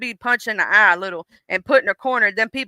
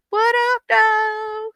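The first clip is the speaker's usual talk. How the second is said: much higher, bubbly hello; high mock greeting; 10 semitones higher, much slower than usual. Exhaustion greeting its victim, much higher and cheery.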